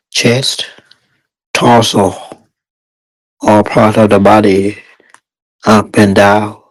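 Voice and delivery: guided meditation. Real, not acted. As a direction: guide meditators step by step, unhurried and even.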